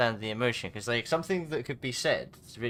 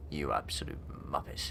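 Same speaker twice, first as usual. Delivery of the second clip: lower, softer, serious put-down; cold insult; 8 semitones lower, 4 dB quieter than usual. Shown as a demonstration. Showing friends how the same words sound hostile, low and serious. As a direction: say it like you mean it as an insult. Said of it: "it's a different tone of voice".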